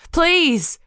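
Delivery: very high, loud scream. A narrator's reading. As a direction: scream out in desperation. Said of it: "I screamed".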